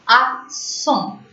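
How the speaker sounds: "Accent" with silent t, ending on a nasal vowel; French pronunciation. Shown as a demonstration. Teacher shows silent letters, French "Accent". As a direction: say "Accent" as ak-SON, falling. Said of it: "T S are silent".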